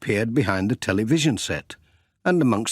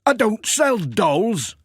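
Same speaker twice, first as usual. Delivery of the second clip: higher, slow indignant shopkeeper; Sam protesting; 11 semitones higher, 3 dB louder, slower than usual. Acted. Sam insisting to Miss Hubbard, slow higher voice. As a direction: draw it out, a bit put out.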